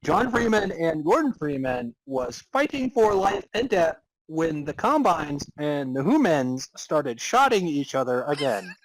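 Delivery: usual speaking voice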